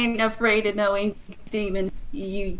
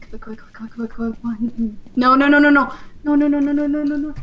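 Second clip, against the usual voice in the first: panicking gamer; 3 semitones higher, 3 dB louder than usual. Real, not acted. Monster chasing, desperate.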